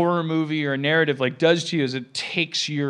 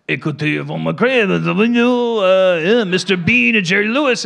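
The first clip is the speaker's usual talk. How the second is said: put-on a light polite French accent, a bit louder; polite French pilot; 3 semitones higher, 8 dB louder than usual. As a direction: mumble it as a courteous French captain. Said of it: "his tone of voice was just polite and French".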